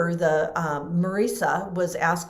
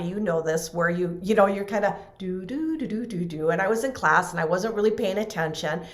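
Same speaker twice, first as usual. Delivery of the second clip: normal talking voice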